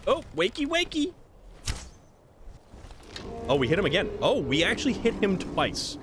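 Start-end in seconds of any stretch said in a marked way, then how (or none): 0.1-1.1 high sing-song wakey wakey, teasing; mock wake-up call. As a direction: coax the enemy awake in a much higher singsong. Taunting a sleeping enemy.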